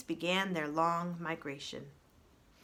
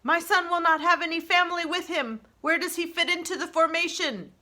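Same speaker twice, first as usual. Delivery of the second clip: booming, much louder and higher; 8 semitones higher, 8 dB louder than usual. Acted. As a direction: boom it out over the whole flock. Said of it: "came a booming voice".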